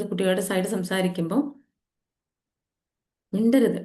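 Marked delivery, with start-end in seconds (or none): 3.3-3.8 a hint of a stern elder snapping at a child; scolding elder. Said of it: "ഇങ്ങനെയൊക്കെ പറയും".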